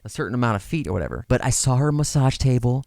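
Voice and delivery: ordinary delivery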